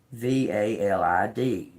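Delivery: V A L I D read out one letter at a time; spelled out. Shown as a demonstration. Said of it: "spelling out a word".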